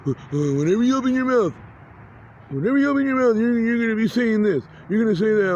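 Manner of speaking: high, insistent drone of a voice; imitated smothering noise. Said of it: "this fucking noise tries to smother me".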